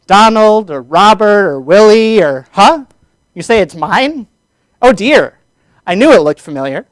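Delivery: animated accusation breaking off into an embarrassed discovery; dramatic reading. Shown as a demonstration. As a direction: act out the punchline with big swoops in pitch. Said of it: "give you all a dramatic reading".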